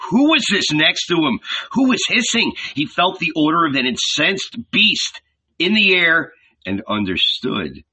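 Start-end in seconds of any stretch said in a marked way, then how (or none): 0.1-6.3 tense narration. A narrator's reading. Narrator builds terror.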